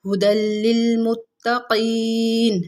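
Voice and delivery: slow, drawn-out Quranic chant; tajweed recitation. Shown as a demonstration. Teacher reciting Quran for students.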